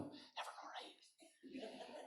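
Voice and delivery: whispered, slow, gentle Larne voice; soft whisper. Retold or imitated. A Larne woman greeting you at her door, whispered. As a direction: breathe it kindly, barely voiced. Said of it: "They start every sentence with".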